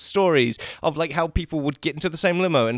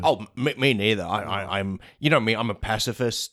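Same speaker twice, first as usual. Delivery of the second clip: Thanos; 7 semitones lower than usual. Acted. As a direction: put on a big low Mad Titan voice. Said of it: "interview with Robert Downey Junior and Thanos".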